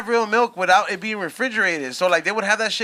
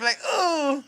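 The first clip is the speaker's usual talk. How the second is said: anime moan; 7 semitones higher than usual. Retold or imitated. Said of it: "always sound like they're getting".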